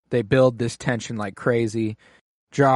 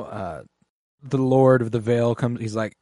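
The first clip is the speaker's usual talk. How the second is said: ordinary talk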